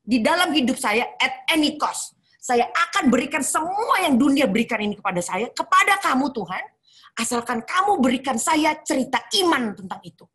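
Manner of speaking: vowing all she owns to God, fervent; fervent plea. Retold or imitated. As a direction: beg with everything. Desperate vow to God.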